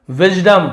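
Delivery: Wisdom called out high and loud; teacher's loud model of wisdom; loud model word. Shown as a demonstration. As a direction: announce wisdom loudly with a falling tune. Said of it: "wisdom यानी ज्ञान".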